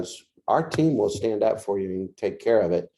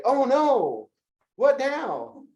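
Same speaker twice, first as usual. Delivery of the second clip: panicked whine; much slower than usual. Retold or imitated. Client panic quote, high pitch.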